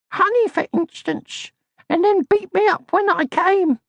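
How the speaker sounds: a tiny high voice, smug and knowing; Little My. A narrator's reading. Little My's scornful thoughts.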